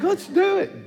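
very high, loud falsetto squeal; mock giddy excitement. Preacher agreeing to a pedicure, mock giddy excitement. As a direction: squeal it with hammed-up eagerness.

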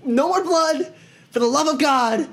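high, desperate plea to companions; panicked pleading. Acted. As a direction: beg your friends in a strained, high-pitched panic. Captive pleads with knife at ribs.

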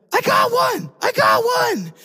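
put-on high, thrilled child's squeal; excited little girl. Niece shouting to her uncle, high and thrilled. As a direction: shout it like a four-year-old with a bite. Niece voiced in fishing story.

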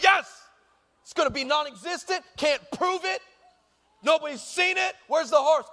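high, excited retold celebration; mock gloating triumph. Boy thinks the evidence is burning, mock triumph. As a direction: crow it like you got away with it. Retells gloating as fire starts.

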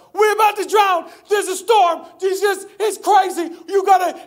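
high, loud panicked shouting; acted panic. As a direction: play disciples crying out in alarm. Disciples panicking in the storm.

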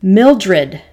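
loud falling shout of the wife's name; panicked full-voice shout. Acted. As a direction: call her across the yard in alarm. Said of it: "I shouted".

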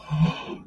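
big re-enacted intake of breath; astonished sharp gasp, acted out; shocked gasp. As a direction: gasp at unbelievable news. Acted gasp at second offer.

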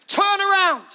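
very high, loud urgent shout; full-voiced warning cry. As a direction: yell it like a street preacher. Preacher calls out as John.

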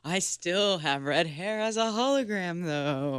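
ghostly hologram voice, slow and airy; glitchy hologram. Pretend. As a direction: speak like a flickering ghostly hologram. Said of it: "my hologram voice sounds like a ghost".

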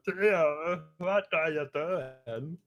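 slow the question right down in a put-on high voice; Gert voice. Answering the co-host with the same silly voice, high slow Gert voice. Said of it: "doing that voice in random places".

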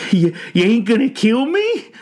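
high, loud stammering question; terrified old man's whimpering plea; frightened pleading. A narrator's reading. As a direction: stammer it in fear for your life. Old man fears for his life.

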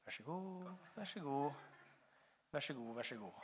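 very soft, as if off to one side; murmured polite offering, repeated; quiet handing out. Acted. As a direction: softly pass the food along the row. Miming handing out food again.

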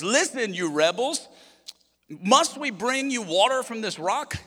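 high, slow, scornful rebuke; angry Moses. Retold or imitated. Moses rebuking the grumbling people at the rock, high and slow with anger. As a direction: snap it at the crowd from high up, then drag it out. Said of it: "Okay, Moses is mad".

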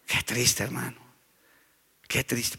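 sad lament. Real, not acted. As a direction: lament it quietly. Lamenting after the mock greeting. (lamento suave y triste)

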